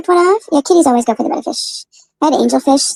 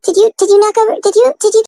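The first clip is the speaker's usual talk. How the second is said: mock scolding the cat; 8 semitones higher, 3 dB louder than usual. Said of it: "I'm like".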